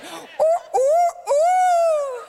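very high, loud crowing; full-blast falsetto rooster imitation. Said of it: "that's a rooster".

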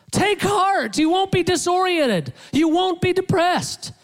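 high, emphatic string of imagined promises; high-pitched mock encouragement; mock pep talk. Voicing what the Lord doesn't say.

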